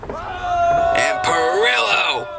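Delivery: long, high stretched call; drawn out high. Real, not acted. Commentator drawing out name.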